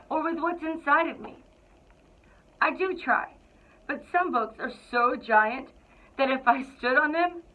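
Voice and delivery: lively, expressive picture-book narration; storybook read-aloud. A narrator's reading. Principal reading to the Cougars, storybook narration. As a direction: read it like you're sharing a favorite book with kids.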